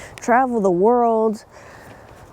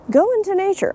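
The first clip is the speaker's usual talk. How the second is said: bright, high-pitched urging; excited; 10 semitones higher than usual. Real, not acted. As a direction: pitch it way up, eager. Cheerful urging outdoors.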